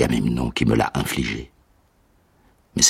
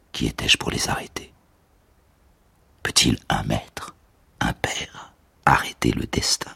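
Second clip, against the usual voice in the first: hushed whisper; 3 semitones lower, 4 dB quieter than usual. A narrator's reading. Old mentor asking himself who could stop fate, whispered. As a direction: let the words fall to a whisper.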